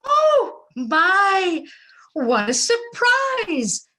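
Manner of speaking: high delighted exclamation; high, loud startled delight; surprised. Acted. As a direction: gasp out a happy surprise. Mother surprised by son.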